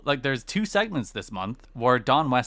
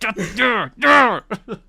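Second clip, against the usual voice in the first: high, loud burst of laughter; delighted squealing laugh; 11 semitones higher, 9 dB louder than usual. Real, not acted. Host reacting to a great promo line, delighted loud laugh.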